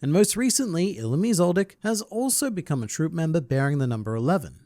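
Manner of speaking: ordinary talk, nothing marked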